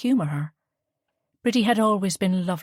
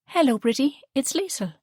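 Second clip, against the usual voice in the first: a touch higher, friendly hello; lightly voiced young woman; 8 semitones higher than usual. A narrator's reading. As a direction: Liesl greets the shopkeeper on the phone. Liesl voiced in dialogue.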